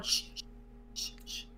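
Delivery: soft, breath-only shushes; imitating a room hushing someone; repeated quiet shushes; imitated shushing. Acting out everyone shushing the group.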